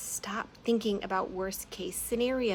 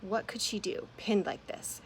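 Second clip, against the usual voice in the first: ordinary delivery, nothing marked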